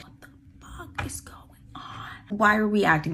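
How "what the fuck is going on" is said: breathy, unbelieving aside; baffled whisper. Retold or imitated. Storyteller baffled by her friend's sarcasm, baffled whisper. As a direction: whisper it, voice all gone.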